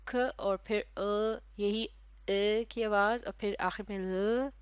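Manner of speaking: क then अ then ल, very slow; slow sounding out. Shown as a demonstration. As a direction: spell out curl sound by sound. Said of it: "शुरू में क्या आवाज़ है".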